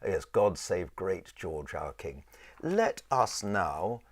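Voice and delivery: normal talking voice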